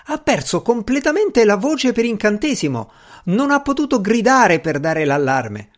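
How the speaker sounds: high servant voice; high-pitched, protesting servant; aggrieved servant. A narrator's reading. Servant pointing out his colleague, aggrieved.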